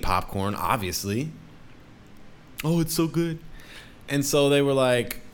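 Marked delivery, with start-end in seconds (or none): 2.6-3.4 high, mock-savouring "so good"; mock glutton. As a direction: lap it up like a gleeful snacker, pitched high. Mocking popcorn eaters savouring.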